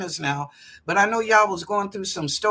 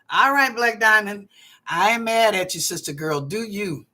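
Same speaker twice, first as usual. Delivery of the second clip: ordinary delivery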